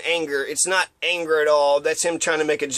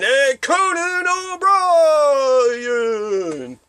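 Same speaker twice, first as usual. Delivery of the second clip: high, slow, hugely stretched announcer call; over-the-top show intro with a long held last name; extravaganza announcer; 6 semitones higher, 4 dB louder, much slower than usual. Retold or imitated. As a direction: belt out the star's name over an imagined band. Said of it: "extravaganza band and music".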